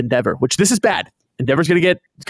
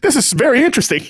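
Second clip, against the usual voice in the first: high, quick, oblivious delight; absorbed All Might; 9 semitones higher, faster than usual. Retold or imitated. All Might opens the coded book, high naive interest. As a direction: marvel cluelessly in a mock hero voice.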